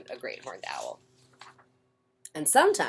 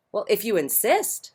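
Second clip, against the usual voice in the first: sweetly; 6 semitones higher than usual. Acted. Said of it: "said the friendly ladybug sweetly".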